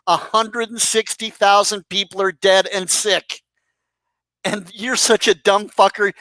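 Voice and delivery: ordinary talk, nothing marked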